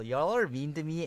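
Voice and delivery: usual speaking voice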